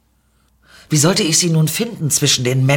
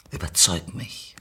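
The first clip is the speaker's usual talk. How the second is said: low, soft, husky challenge; challenging stranger; 5 semitones lower, 7 dB quieter than usual. Acted. Mysterious woman challenges the narrator, low and soft. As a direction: dare them in a low, soft voice.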